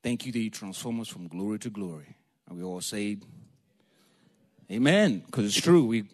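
usual speaking voice

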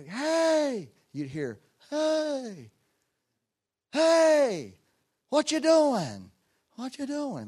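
high, loud calls fading like an echo; boy yelling into the evening bottom, playing echo; yelled echo. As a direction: shout across the bottom and let it bounce back. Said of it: "go out there in the evenings and yell, and there'd be an echo".

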